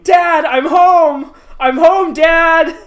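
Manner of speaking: high, very loud drawn-out call; screaming. Retold or imitated. Imitating Alan searching home for his father, screaming. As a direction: holler through the whole house, stretching the words. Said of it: "runs around the house screaming".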